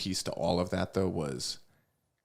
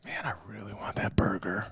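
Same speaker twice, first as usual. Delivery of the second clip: craving whisper; 3 dB quieter than usual. Retold or imitated. Voicing craving inner thought.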